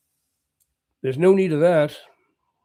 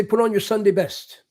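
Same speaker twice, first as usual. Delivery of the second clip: put-on old saying; 3 semitones higher than usual. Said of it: "they used to say".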